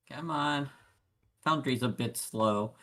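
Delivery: ordinary delivery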